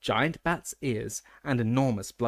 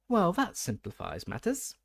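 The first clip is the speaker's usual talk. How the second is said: cheerfully; 7 semitones higher than usual. A narrator's reading. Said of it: "said Dumbledore cheerfully".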